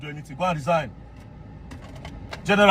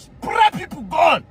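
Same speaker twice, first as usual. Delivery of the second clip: outraged shout; 8 semitones higher, slower than usual. Real, not acted. Describing gunmen attacking a church, a slow outraged shout. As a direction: drag it out in disbelief and fury.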